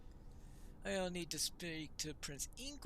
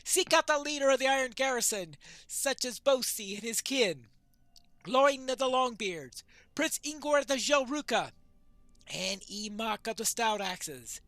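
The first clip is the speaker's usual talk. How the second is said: loud, higher-pitched rallying orders; commanding quest-giver; 4 semitones higher, 8 dB louder than usual. Acted. Leader sends for the dwarf captains, commanding. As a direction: declaim the summons with force.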